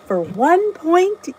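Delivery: to the viewers, repeating the points playfully and slowly; slow sing-song. Real, not acted. Stretched out, ending on a lift.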